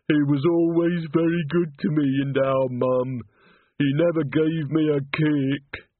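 put-on high, slow, fretful young man's voice; a grieving simple lad, slow and high; tearful simple young man. Walter mourning the chorus master, high and slow.

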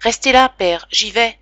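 plus haut et plus fort, pressé; élan inquiet. Acted. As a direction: coupe court et lance-toi vers la porte. Fils se lève, inquiet, sonnette. (élan inquiet, plus haut et plus fort)

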